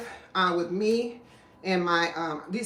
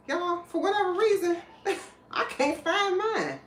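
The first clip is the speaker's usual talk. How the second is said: very high, plaintive mock whine; exaggerated squeaky dismay; comic whine; 10 semitones higher than usual. Real, not acted. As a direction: whine it up high with a laugh in the middle. Comic dismay at a lost gadget.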